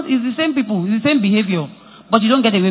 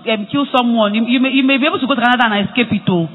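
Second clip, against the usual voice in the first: usual speaking voice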